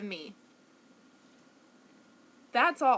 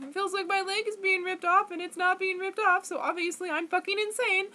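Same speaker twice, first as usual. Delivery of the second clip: high, louder retelling of distressed thoughts; mock-distressed self-quote, high and insistent; acting out crazy panic; 8 semitones higher, 5 dB louder than usual. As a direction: replay your frantic inner voice, pitched up. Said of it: "I thought I was a crazy person".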